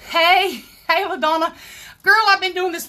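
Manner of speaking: light up and greet your friend; excited greeting. Real, not acted. Greeting group creator live.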